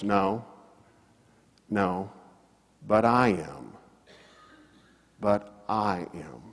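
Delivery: low, soft, with long pauses; hushed, unhurried imitation of a firm reply; quiet retold answer. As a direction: answer gently and let it land. Missionary's humble answer to critic.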